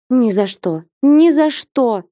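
rising to a high, loud, slow cry of refusal. Acted. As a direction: cry it out, building on the repeat. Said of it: "вскрикнула Настя".

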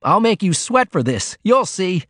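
high, indignant threat; snarled. A narrator's reading. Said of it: "he snarled".

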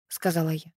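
usual speaking voice

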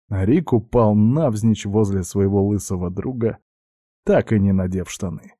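usual speaking voice

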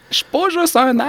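high-pitched protest, played for laughs; comic high-voiced objection; mock protest. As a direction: imitate the hero objecting, voice jumping way up. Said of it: "puis il est comme genre".

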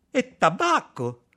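high, loud, drawn-out eager question; eager excitement. A narrator's reading. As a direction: ask with mounting, greedy hope. Tiaden's hopes mount, jaws trembling. (domanda eccitata e incalzante)

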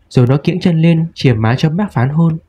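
usual speaking voice

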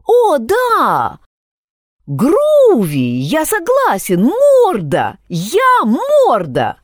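high, loud, thrilled cheer; overjoyed, drawing out the end; delighted shout. A narrator's reading. Maikl delighted with nickname.

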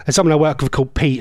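ordinary talk

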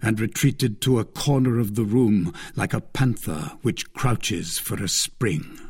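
ordinary delivery, nothing marked

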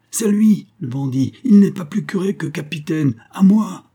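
high, loud accusing cry; furious shout. Acted. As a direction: yell for help as he charges. Gondet attacks the false priest.

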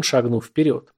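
ordinary delivery

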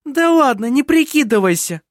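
high, loud, scornful interjection; impatient, angry retort; sharp interruption. A narrator's reading. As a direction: cut in and call out the pretence. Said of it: "перебила Лисин".

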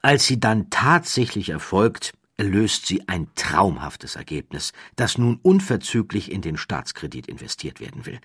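ordinary delivery

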